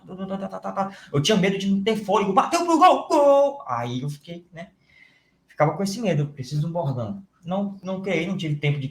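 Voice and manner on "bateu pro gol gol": soaring radio goal shout; high, loud football narrator yell; goal call. Shown as a demonstration. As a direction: belt it like a live goal call.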